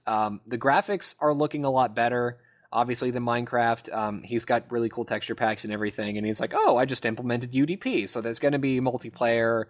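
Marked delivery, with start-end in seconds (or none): none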